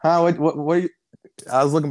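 high, loud confused what-what; baffled huh. Retold or imitated. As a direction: sputter in mock bewilderment. Retold baffled reaction, looking for cat.

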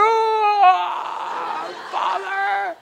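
high, dramatic cry to heaven; hammed-up biblical wail; Moses movie prayer. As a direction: call out like an epic film prophet. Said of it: "praying like we're in a Moses movie".

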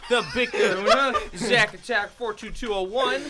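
slow, loud hyped announcer. Acted. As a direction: introduce the guest like a game show. Announcer intro of guests.

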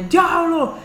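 high-pitched hyped cry, imitating a raving moviegoer; mock-shout it like someone bursting out of the cinema; mock excited yelling. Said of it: "salir del cine gritando".

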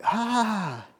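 high, drawn-out "ah" of pain; mock scalded gasp. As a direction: act out a burnt-tongue cry. Sipping boiling coffee, retold.

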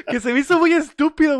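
high, loud words through laughter; laughing while talking. Real, not acted. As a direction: push the words out mid-laugh. Laughing at the movie.

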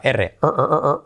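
quick tapped er, er, er, er like a Spanish r; tapped r drill. Shown as a demonstration. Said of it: "T como R".

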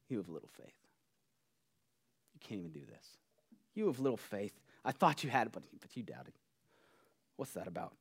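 quiet, low, disappointed put-down; condescending tone. Acted. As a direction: murmur it down at them with condescension. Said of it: "he has this condescending tone".

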